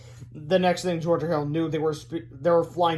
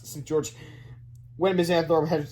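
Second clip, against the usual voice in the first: ordinary delivery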